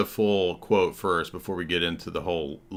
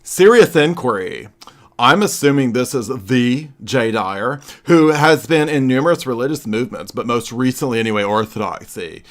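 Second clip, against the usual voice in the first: louder, higher pompous mocking read of his post; 4 semitones higher, 7 dB louder than usual. Host mocking a critic's post, reading it in a pompous loud voice. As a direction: sneer out his words like a stuffy scholar.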